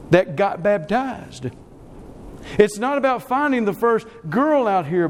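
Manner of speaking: ordinary talk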